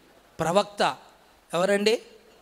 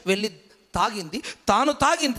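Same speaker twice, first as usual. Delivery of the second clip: ordinary delivery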